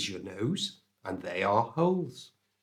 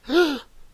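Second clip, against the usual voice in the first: high, loud pretend gasp; mock shocked gasp; 8 dB louder than usual. Teacher joking about showing naked flesh, mock shocked gasp. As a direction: gasp in exaggerated fake shock.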